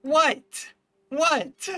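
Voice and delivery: high, loud shocked "What?". Real, not acted. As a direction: squeak it in disbelief.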